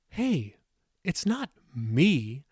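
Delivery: slow, drawn-out mock protest; quipping. A narrator's reading. Molly blames her unborn baby to her mother, slow quipping protest. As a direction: stretch out the playful excuse. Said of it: "Molly quipped".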